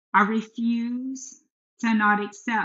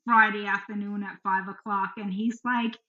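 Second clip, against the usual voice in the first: ordinary talk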